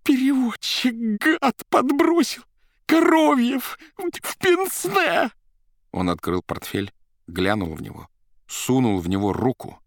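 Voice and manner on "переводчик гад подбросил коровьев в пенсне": high, loud, broken outbursts; incoherent shouting. Acted. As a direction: yell in a frantic jumble. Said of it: "бессвязно при этом вскрикивая".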